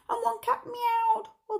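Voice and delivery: high meowing voice; cat meow voice. Acted.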